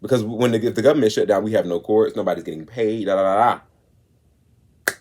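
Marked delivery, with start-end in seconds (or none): none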